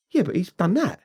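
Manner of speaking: high-pitched imitation of an indignant objection; stubborn protest. Retold stubborn protest about a grudge.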